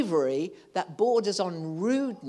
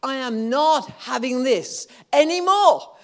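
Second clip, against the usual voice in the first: firm defiant declaration; 6 semitones higher, 9 dB louder than usual. Pretend. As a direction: plant your feet and refuse. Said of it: "do you shut that door occasionally and say".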